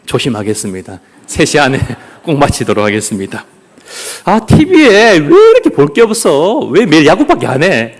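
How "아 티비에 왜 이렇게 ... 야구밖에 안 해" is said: high, exasperated whine at first; mock complaint. Viewer bored with TV, playfully complaining. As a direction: play up the petty grievance. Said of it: "라고 불평합니다".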